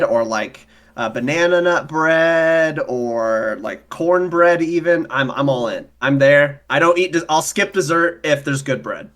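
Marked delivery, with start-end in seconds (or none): none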